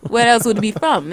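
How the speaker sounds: put-on lightly done Wakandan accent, African lilt; a hint of a Wakandan accent, matter-of-fact. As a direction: answer like a proud Wakandan. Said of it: "we speak in a Wakandan accent".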